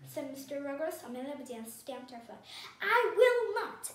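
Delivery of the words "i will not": loud, high, slow and emphatic; stubborn, stamping outburst; indignant refusal. A narrator's reading. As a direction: refuse flat out, stressing each word. Indignant refusal, stamped her foot.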